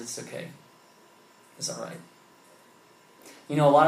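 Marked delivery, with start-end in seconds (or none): 0.0-2.0 softer, kind and reassuring; gentle reassurance. Retold or imitated. The deli owner smiles at the cranky kid, softly reassuring. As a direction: let him off gently, softly. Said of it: "and he just smiles".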